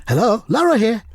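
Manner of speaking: put-on phone voice, pitched high; Mark Lawrenson. Comedian as Mark answering the phone, in high phone voice. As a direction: answer in a high comic telephone voice. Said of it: "Is that his phone voice?".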